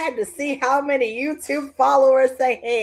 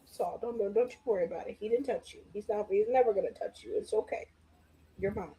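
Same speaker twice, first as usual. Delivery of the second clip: soft hushed comfort to a soda; mock-tender whisper to a Doctor Pepper; tender cooing; 5 semitones lower, 11 dB quieter, faster than usual. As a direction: soothe it like a scared pet.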